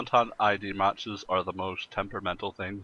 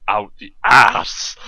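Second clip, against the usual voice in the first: much louder, slow, bellowed punchline; loud comic shout; 10 dB louder, much slower than usual. Real, not acted. Joking with co-hosts about infringement, loud slow shout. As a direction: blurt it out as a joke.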